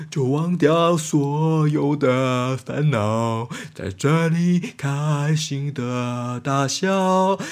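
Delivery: low, slow sagging song; dying tape recorder. Acted. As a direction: sing like a tape with flat batteries. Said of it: "很像那个电池没电的录音带".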